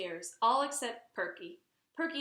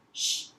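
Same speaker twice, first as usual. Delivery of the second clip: sharp shush; 5 dB louder than usual. Acted. Mother shushing DW in front of the guest. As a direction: hush her quickly. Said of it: "said Mother".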